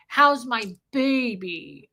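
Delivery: slow, sugary coo; mother-in-law cooing at her grown son, slow and syrupy; syrupy doting mother. Retold or imitated. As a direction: stretch it out like sticky syrup. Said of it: "slimy and, like, syrupy and weird".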